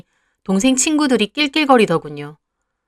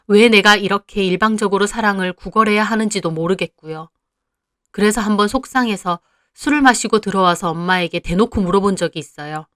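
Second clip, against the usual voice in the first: normal talking voice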